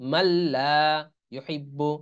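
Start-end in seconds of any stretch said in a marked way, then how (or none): 0.1-0.7 মাল্লা with a long doubled l, no n sound; মাল্লা as in tajweed idgham; merged nun into lam. Shown as a demonstration. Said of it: "কেউ মানলা পড়া যাবে না".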